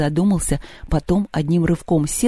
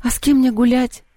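higher, plaintive question; mildly woeful, pitched up; sighing complaint; 8 semitones higher than usual. Acted. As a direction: ask it with a self-pitying sigh. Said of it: "вздохнула Маша".

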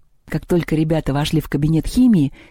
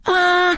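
short high-pitched goblin scream; sharp, hammed-up "A!"; high yell. A narrator's reading. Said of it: "заорал кабинетный".

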